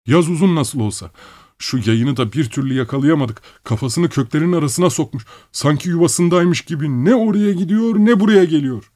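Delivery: high-pitched workman making excuses; workman Gerasim. A narrator's reading. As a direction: explain to the master in a high character voice.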